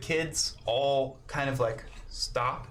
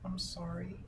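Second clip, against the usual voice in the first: put-on quiet, higher little girl; small child apology; 5 semitones higher, 10 dB quieter than usual. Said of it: "Chelsea is kind of like".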